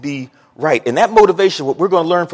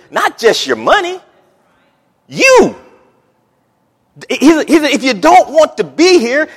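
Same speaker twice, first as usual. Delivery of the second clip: higher, louder, rising preacher fervor; preaching at full force; 9 semitones higher, 5 dB louder than usual. Acted. Pastor urging the congregation, loud and high.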